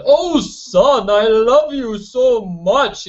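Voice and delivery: imitating Bowser telling his son he loves him; weird Bowser voice. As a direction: croon it like a weird villain dad. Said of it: "his voice is like that".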